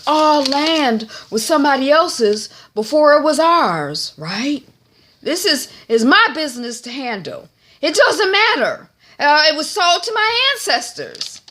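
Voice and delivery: loud, high acted indignation; heated defensiveness. Old man defending his land, heated defensiveness. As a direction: argue back forcefully, pitch leaping up.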